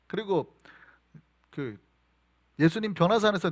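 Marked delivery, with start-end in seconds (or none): none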